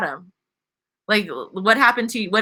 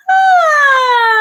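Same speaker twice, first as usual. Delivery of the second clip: high, loud open "Ah!" with the mouth wide; 9 dB louder than usual. Shown as a demonstration. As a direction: belt a bright high "Ah!".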